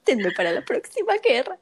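laughing, fast, quoting the grandma; laughing quote. Retold or imitated. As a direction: giggle through the line. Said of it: "que hasta dice la abuelita".

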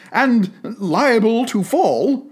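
put-on remonstrating old Scrooge. A narrator's reading. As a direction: plead your case with the spirit. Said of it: "Scrooge remonstrated".

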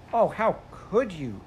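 put-on a girl horrified at the bird; frightened cry. A narrator's reading. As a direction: Jane crying out as the fire spreads. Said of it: "cried Jane".

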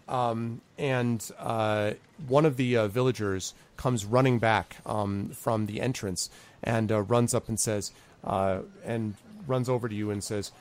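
ordinary talk

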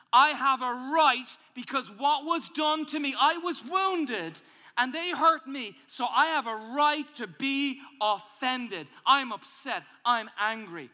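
loud, slow, wounded indignation; a hurt man working himself up into anger; indignant anger. Acted. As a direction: insist on your right to be offended. Voicing the wounded, offended self.

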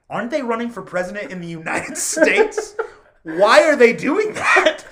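incredulous, laughing, slowing down; baffled friends cracking up; laughing disbelief. Retold or imitated. Mexican friends mocking the candidates, laughing in disbelief. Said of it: "my Mexican friends said".

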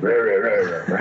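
imitated mean devil ranting; mock a devil's wordless scolding; lightly voiced devil ranting in nonsense syllables. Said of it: "like mean like that".

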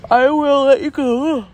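high words stretched by a yawn. Real, not acted. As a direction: say it right through a yawn. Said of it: "I keep yawning".

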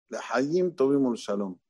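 ordinary delivery

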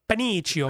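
Pinocchio said pin-EE-chee-oh; deliberate mispronunciation. Shown as a demonstration. Joking about a pepper, Pinocchio as pin-EE-chee-oh. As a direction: say Pinocchio to rhyme with Benicio. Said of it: "I like to say Pinocchio".

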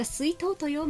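lightly voiced cute Hakata girl. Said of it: "要は可愛い女の子が".

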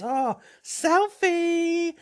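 very high, drawn-out excited squeal; squealed selfie call. Retold or imitated. One woman squeals to the group for a selfie, very high and drawn out. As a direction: shriek it like a giddy friend spotting a photo op.

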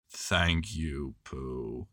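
grateful but mopey; gloomy Eeyore. A narrator's reading.

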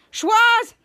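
high, loud summoning shout; calling out. Retold or imitated. Captain summoning Schwoz to the phone, loud call. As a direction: holler the name for someone off-screen.

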